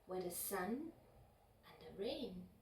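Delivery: plain reading voice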